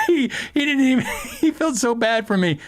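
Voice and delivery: high, laughing through the words. Real, not acted. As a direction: talk while cracking up.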